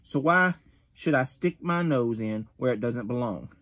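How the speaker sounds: usual speaking voice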